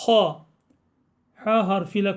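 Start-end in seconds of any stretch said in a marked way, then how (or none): 0.1-0.4 ha as a rasped kh; throaty kha. Shown as a demonstration. Teacher shows the letter hı, ha with a throaty rasp. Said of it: "Biraz daha hırıltılı şeklinde".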